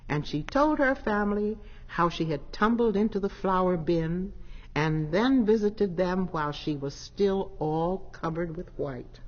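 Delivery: ordinary delivery, nothing marked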